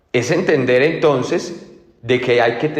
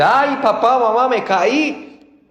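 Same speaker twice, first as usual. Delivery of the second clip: put-on child crying out; 10 semitones higher, slower than usual. Child on the path calling to dad after a fall, high wail. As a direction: call out like a small child who just fell.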